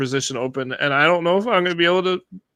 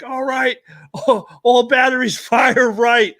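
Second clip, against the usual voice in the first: higher, drawn-out calling of an order; mock fire command; 8 semitones higher, 3 dB louder, slower than usual. Host playing spacecraft crew ordering fire, mock command voice. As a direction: call it out like a gunnery command. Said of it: "they would be like".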